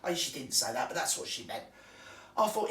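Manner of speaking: ordinary delivery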